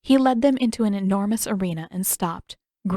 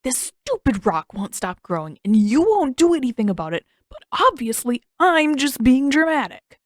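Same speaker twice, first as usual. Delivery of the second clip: building, louder sarcastic complaint; sarcastic rant; 5 semitones higher, 6 dB louder than usual. Acted. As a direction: spit out the sarcasm.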